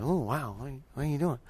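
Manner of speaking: very soft mock dismay; hushed disapproval. Preacher mimicking worshipers reacting to someone jumping, hushed disapproval. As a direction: murmur it like an uneasy onlooker.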